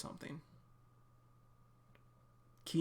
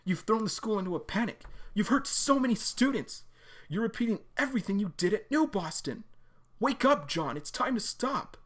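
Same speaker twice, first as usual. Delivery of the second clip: raised, higher voice confronting him; pleading anger; 7 semitones higher, 3 dB louder than usual. Acted. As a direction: beg him to stop, voice up high. Sarah confronts John angrily.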